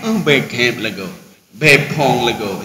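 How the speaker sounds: slow, low "be cap lego", "Be pong lego"; Acehnese phrases. Shown as a demonstration. Preacher listing Acehnese smell words, low and slow. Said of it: "Kemudian cap".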